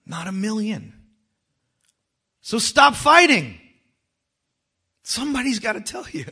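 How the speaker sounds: high, loud pulpit exhortation; impassioned preaching. Real, not acted. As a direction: exhort the congregation, leaving dramatic pauses.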